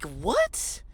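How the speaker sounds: mock disbelief; baffled. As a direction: react in exaggerated bafflement. Acts out baffled reaction.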